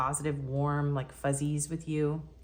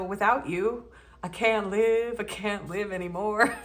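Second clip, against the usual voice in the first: mock dramatic; 4 semitones higher, 4 dB louder than usual. Hamming up the message.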